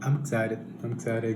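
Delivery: ordinary talk, nothing marked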